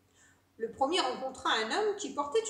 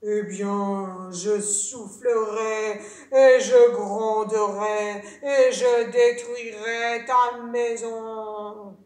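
low, loud, very slow threat; big bad wolf, heavy and slow; menacing wolf; 5 semitones lower, 10 dB louder, much slower than usual. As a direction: draw out each threat in a deep wolf voice.

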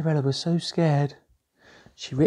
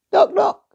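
high knocking; 4 dB louder than usual. A narrator's reading. Knock sound imitated.

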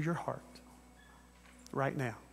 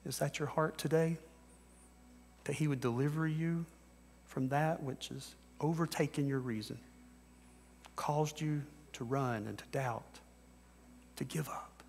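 softer and softer, near a whisper; hushed sermon close; 6 dB quieter than usual. Acted. Preacher easing listeners toward prayer, hushed. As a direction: let it sink to a hush.

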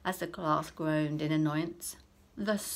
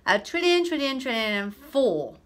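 high, loud butting in; cheeky interruption; 6 semitones higher, 9 dB louder than usual. Acted. Clever boy interrupting the teacher, loud and cheeky. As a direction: cut in with the next number. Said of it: "boy interrupts teacher".

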